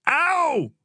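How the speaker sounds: high-pitched "ow"; short high cry of hurt; pained yelp. A narrator's reading. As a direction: yelp in sudden pain. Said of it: "Joel yelped".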